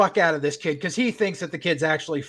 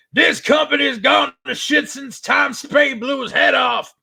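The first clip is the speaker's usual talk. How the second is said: higher, slow, shouted wrestling-promo voice; mock promo shout; 8 semitones higher, 3 dB louder, slower than usual. Washed-up veteran hijacking the ring mic, hammed-up shout. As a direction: ham up a veteran cutting a promo. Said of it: "And he's all like".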